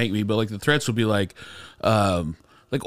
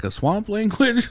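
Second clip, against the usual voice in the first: mock clueless question; 10 semitones higher, slower than usual. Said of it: "I think people are like".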